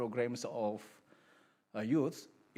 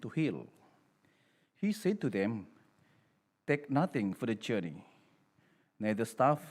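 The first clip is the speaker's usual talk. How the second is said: solemn, louder priestly reading; Gospel proclamation; 5 dB louder than usual. Acted. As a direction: proclaim it to the whole congregation.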